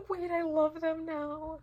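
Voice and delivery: high, slow, squealing delight; gushing excitement. Real, not acted. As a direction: swoon over the couple out loud. Sudden gushing over couple.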